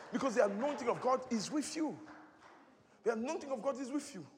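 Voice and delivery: preacher's rolling declaration; sermon cadence; preaching. Acted. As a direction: proclaim it to the congregation.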